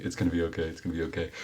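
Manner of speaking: soft, low comforting voice; soothing reassurance. Acted. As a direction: murmur it softly to console a colleague. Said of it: "patting him on the shoulder".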